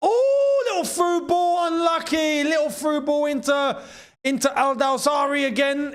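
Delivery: excited shout. Real, not acted. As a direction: call the through ball as it happens. Exciting chance in the match.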